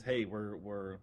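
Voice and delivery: normal talking voice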